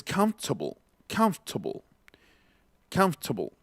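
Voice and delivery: comfortable said in three syllables, the OR swallowed, like "cumf-ta-bl"; reduced English pronunciation. Shown as a demonstration. Teacher showing viewers how comfortable really sounds, reduced pronunciation. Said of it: "pues en realidad ya saben que es".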